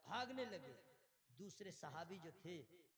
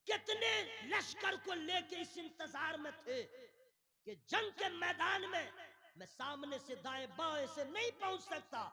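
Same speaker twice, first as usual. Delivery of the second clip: high, raised dramatic telling; full-force preaching; 10 semitones higher, 10 dB louder than usual. Real, not acted. Preacher telling of Khalid's waiting army, impassioned. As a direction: drive the story on forcefully.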